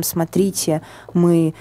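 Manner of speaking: usual speaking voice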